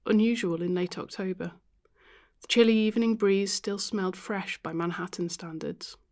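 ordinary delivery, nothing marked

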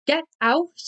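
high, loud command; angry yell. A narrator's reading. Reeling Tony ordering the narrator out, loud yell. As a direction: drive it out as a final order.

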